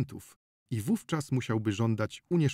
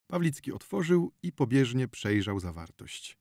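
plain reading voice

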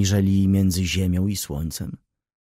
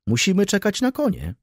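baron's line lifted much higher; holding forth; 12 semitones higher than usual. A narrator's reading. Baron explains the horse delay to Wokulski, pitched high. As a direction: hold forth cheerfully, pitched up. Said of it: "prawił baron".

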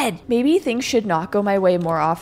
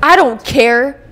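defiant; 4 semitones higher, 9 dB louder, slower than usual. Real, not acted.

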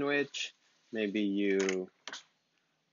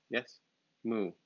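ordinary talk

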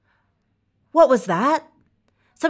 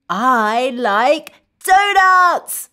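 slow, emphatic happy declaration; delighted, word by word for kids; enthusiastic liking; 4 dB louder, slower than usual. Shown as a demonstration. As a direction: draw out each word with relish. Modelling liking donuts for children.

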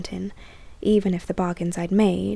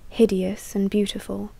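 plain reading voice

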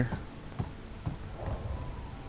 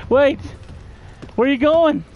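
high panicked shout; startled, yelling after it; scared yelp; 11 semitones higher than usual. Real, not acted.

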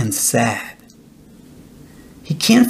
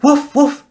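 very high double woof; gleeful dog barking; happy barks; 11 semitones higher than usual. A narrator's reading. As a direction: bark round in circles. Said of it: "and barks again".

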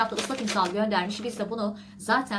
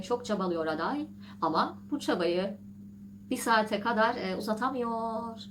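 ordinary delivery

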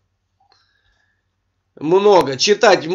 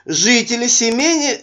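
ordinary talk

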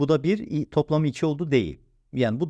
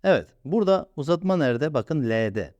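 normal talking voice

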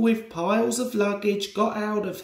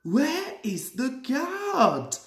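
whistled call; 7 semitones higher, slower than usual. A narrator's reading. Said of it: "whistled Thomas".

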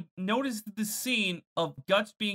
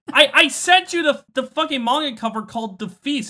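loud, higher-pitched rebuttal; exasperated protest; 7 semitones higher, 6 dB louder than usual. Real, not acted. Reminding a co-host about the manga cover, exasperated protest. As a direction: argue back, raised and stumbling.

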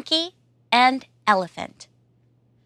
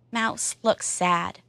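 ordinary delivery

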